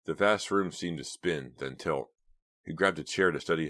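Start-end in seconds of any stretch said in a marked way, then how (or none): none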